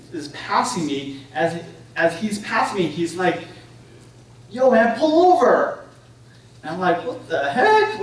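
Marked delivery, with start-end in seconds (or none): 4.5-5.8 higher, louder, drawn-out holler; retold roadside call. Retelling motorcyclist yelling to driver. 7.1-8.0 high-pitched, incredulous retold reaction; mock bewilderment. Retelling his startled reaction to biker.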